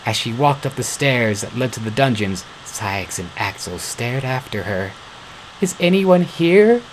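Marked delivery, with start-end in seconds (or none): 5.6-6.8 calling out. A narrator's reading. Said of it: "she called out into the cold room".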